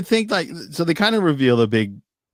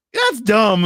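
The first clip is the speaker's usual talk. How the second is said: higher, louder dismissive outburst; annoyed; 7 semitones higher, 5 dB louder than usual. Retold or imitated. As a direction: burst out in irritated disbelief. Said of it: "I was so annoyed".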